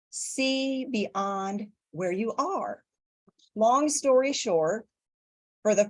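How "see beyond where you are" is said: slow quoted voice. Retold or imitated. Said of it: "When I heard God say".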